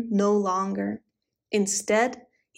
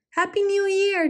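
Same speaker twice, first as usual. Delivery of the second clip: much higher squeaky echo; cried out; 9 semitones higher than usual. A narrator's reading. Squirrels calling to their friend, high squeaky cheer. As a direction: squeak the greeting happily. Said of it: "they cried".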